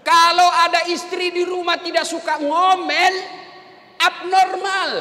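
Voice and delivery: loud, rising sermon voice, punching each phrase; fiery preaching. Acted. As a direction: preach it at full force to the congregation. Preacher rising to full force.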